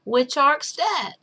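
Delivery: slow, mock-puzzled old man's question; feigned curiosity. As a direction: pretend not to know, teasing the boy. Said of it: "in a tone of well-feigned curiosity".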